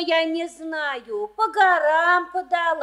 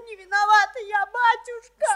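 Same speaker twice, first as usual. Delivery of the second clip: tearful pleading; 4 semitones higher than usual. Acted. Daughter pleads, crying.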